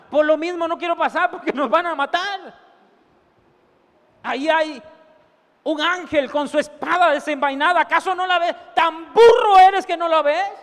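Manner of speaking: donkey mocking the prophet who can't see the angel; scolding donkey.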